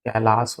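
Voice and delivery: कैलाश low and soft, with final s; correct final s. Shown as a demonstration. Teacher confirming the correction, कैलाश ending in s. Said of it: "होता है".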